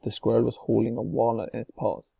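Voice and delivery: ordinary delivery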